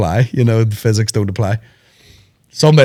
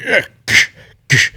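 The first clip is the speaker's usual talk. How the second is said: mock fight noises; 6 semitones higher than usual. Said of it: "So he's like".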